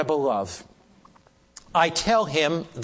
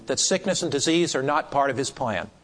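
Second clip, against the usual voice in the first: ordinary talk, nothing marked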